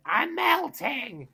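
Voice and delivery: very high, drawn-out witch wail; hammed-up dying witch, stretched out at the top of the voice; melting witch. Witch gets water thrown on her, mock dying wail. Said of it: "when the witch gets water thrown on her".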